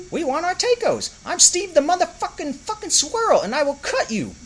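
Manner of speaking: a tiny thug squirrel threatening; Steve spitting threats. Acted. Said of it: "spit Steve right back".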